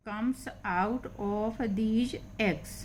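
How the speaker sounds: very slow, stretched reading; slow model sentence; slow reading aloud. Shown as a demonstration. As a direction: draw each word out for learners. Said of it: "teacher reads slowly".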